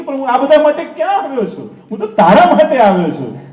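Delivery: playful God, laughing and high; Thakorji speaking. Acted. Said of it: "Thakorji કે".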